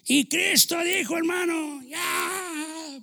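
high, loud, slow cry to the brothers; preaching shout. Real, not acted. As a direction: call out like a preacher at full force. (grito de predicador)

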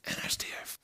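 soft unvoiced whisper. Real, not acted. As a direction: breathe it out quietly. Repeated line whispered.

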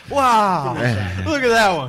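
loud, drawn-out mock amazement; hammed-up gawping wonder, loud and slow; mock wowed. Teasing a friend who stared at the show, mock wowed.

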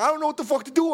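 high-pitched frantic helplessness; mock panicked outcry; acted helpless panic. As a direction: imitate a brother at a total loss. Brother panicking in the flood.

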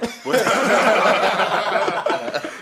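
big high laugh; loud burst of laughter. Real, not acted. Laughing before accent attempt.

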